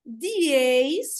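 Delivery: "Dieis" emendado e bem esticado; sílabas juntas. Shown as a demonstration. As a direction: junte as vogais de "Dieis", devagar. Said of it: "fica junto". ("Dieis" com di e eis unidos, lento)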